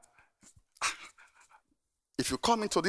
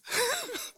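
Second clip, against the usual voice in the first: high laugh; 6 dB quieter than usual. Real, not acted. Laughs at his own story.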